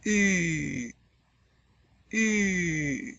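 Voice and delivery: French U with rounded lips; lips pursed. Shown as a demonstration. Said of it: "say E, but with rounded lips".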